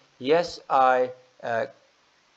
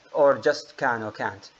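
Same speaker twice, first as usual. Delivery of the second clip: usual speaking voice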